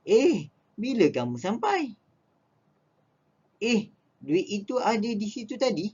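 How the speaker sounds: high startled exclamation; high surprised eh. Shown as a demonstration. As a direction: caught off guard. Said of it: "hairan dan juga terkejut".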